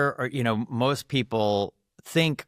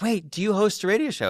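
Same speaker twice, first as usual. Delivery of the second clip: quick, high, surprised stranger; a passerby catching on mid-step; mock stranger question; 12 semitones higher, 3 dB louder, faster than usual. Stranger at dinner recognizing the radio host, quick and high.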